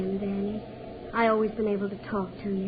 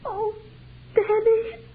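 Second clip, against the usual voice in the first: high rising cry of his name; emotional cry; 11 semitones higher, 4 dB louder, much slower than usual. Acted. Ruth torn as she leaves, a high cry. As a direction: call out to him, overcome.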